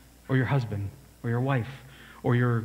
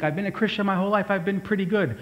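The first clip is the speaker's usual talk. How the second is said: lightly mocking smug churchgoer, higher and fast; mock self-satisfied; 7 semitones higher, faster than usual. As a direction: toss it off like a self-pleased believer. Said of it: "And you may think".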